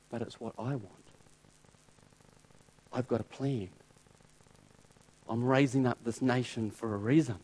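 quiet, settled authority; low, soft, unhurried God voice. Pretend. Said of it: "It's like God saying".

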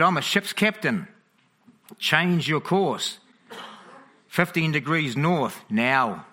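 ordinary talk

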